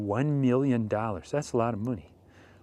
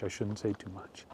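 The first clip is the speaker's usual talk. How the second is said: repeats it much softer, like a secret; hushed aside; 4 semitones lower, 12 dB quieter than usual. Acted. A host hinting at gossip, hushed aside. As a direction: drop to a conspiratorial hush.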